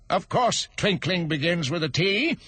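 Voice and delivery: high curt retort; sharp. Acted. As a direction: snap at him. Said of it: "said the king sharply".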